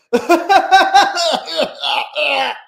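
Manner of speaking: high, loud manic cackle imitating the Joker; Joker laugh. Viewer hears him imitate the Joker's uncontrollable nervous laugh, high and manic. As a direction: cackle like a nervous movie villain. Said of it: "He's like".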